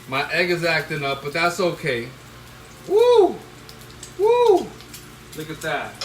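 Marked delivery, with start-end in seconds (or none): 2.9-4.7 high loud whoops of delight; joyful whoop. Real, not acted. Cook whoops with joy at his plate. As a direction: celebrate the food out loud.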